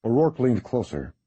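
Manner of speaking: plain reading voice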